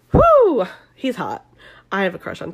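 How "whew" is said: high, loud "whew"; loud, high-pitched swoon. Real, not acted. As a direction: whoop it out like you're swooning. Swooning over hot pilot.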